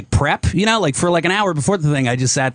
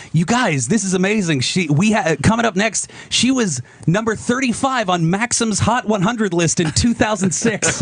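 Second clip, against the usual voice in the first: higher hyped-up host voice introducing a guest; roast intro announcer; 7 semitones higher than usual. Retold or imitated. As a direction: announce her like an emcee at a roast. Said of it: "I wrote Sarah Silverman's intro".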